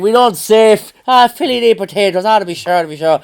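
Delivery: put-on loud, high Irish accent, ranting; imitating loud Irishman. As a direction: rant as the loud Irish mate mocking Irish cliches. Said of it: "He's like".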